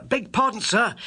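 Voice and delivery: put-on high, loud eager servant boy; a breathless young page at the door. Page boy interrupts Holmes at the door, high and loud. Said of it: "said our page boy".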